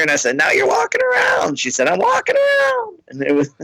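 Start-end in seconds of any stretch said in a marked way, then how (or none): 0.4-1.6 much higher, shouted; mock celebration. Comic cheering the fan's walking, shouted high. 1.9-3.0 much higher, triumphant; fan exulting. Retold or imitated. Fan exulting to the comic, very high.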